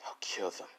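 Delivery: breathy whispered menace, no voice at all; whispered threat. Acted. Boy whispering his murder plan to himself. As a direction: hiss the threat under your breath. Villain mutters threat, then corrects.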